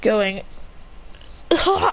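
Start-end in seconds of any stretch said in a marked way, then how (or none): 1.5-1.9 high short "ah"; high gasping yelp; acted pain cry. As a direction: cry out from the wound. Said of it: "shoots wood pellets into his, into the wound".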